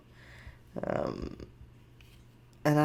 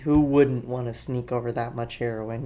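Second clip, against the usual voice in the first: usual speaking voice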